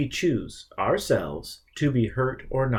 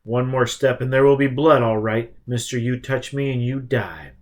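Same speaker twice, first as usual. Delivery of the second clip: a raised, hard warning, much louder; loud threat; 2 semitones higher, 6 dB louder than usual. Acted. Richard warning the advancing vampire, loud and threatening. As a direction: stop him cold with your voice.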